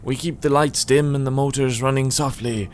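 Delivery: put-on old man slipping into Irish; slightly Irish lilt, unintended; accidental Irish accent. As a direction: let a hint of Irish creep in. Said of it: "I'm getting Irish all of a sudden".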